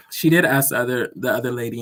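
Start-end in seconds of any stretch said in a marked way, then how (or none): none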